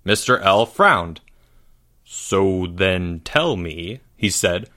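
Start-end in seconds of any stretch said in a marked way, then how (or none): 2.0-4.0 very slow, lower, deliberate teacher; pronouncing every word. A narrator's reading. Teacher presses a pupil for the truth, pronouncing every word. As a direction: spell it out word by word. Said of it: "pronouncing every word".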